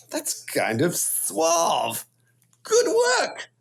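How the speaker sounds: slow, self-satisfied murmur sliding into a breathy whisper; lightly voiced suave self-praise; smug mirror talk. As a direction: wink at yourself in the mirror, then whisper the praise. Admiring himself in the mirror.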